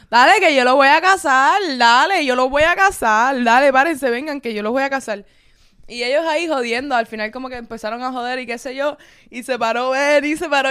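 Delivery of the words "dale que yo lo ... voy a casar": loud, insistent rallying; pushy drunk. Retold or imitated. Drunk stranger loudly offering to marry the couple. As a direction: push them to stand up and wed. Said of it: "él le dice".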